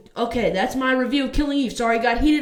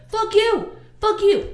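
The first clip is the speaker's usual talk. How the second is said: shouted fuck you, high and loud; angry outburst; 11 semitones higher, 4 dB louder, slower than usual. Real, not acted. Vlogger cursing out the new writer, shouting high and loud. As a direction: let it rip at the writer, furious.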